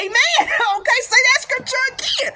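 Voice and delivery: high, excited amen from the pew; gleeful high-pitched approval; hyped. Retold or imitated. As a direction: cheer the preacher on, laughing. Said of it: "I'm, I'm, I'm hyped".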